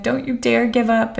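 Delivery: lightly imitated New York accent, pitched a bit up; hint at a mentor's New York accent; imitating New York accent. Mentor replying to a sad text, light New York accent. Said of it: "like, New York accent".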